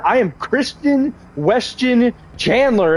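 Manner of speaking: a gentler retake of his slow self-introduction; lighter Chris Chan imitation. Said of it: "and I heard".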